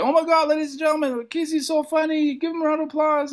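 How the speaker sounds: high, hurried hype voice; retold host gushing over a comic; excited emcee. Host to the audience after the set, high-pitched hype.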